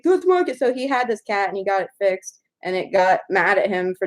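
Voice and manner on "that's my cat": high imitated protest; friend's indignant claim. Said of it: "And he was like".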